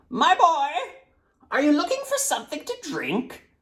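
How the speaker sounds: put-on high, thin old man calling out; old man's voice. A narrator's reading. Old man on the fountain calls to the thirsty boy, high and thin.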